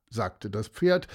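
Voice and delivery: ordinary delivery, nothing marked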